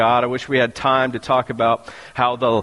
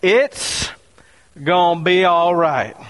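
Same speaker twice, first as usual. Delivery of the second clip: reassuring; 5 semitones higher, slower than usual. Acted. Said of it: "But she said".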